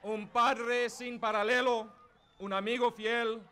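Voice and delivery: shouted ring announcer build-up; lucha libre announcer. Real, not acted. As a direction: hype the crowd like a ring announcer. Bilingual rally introduction, shouting.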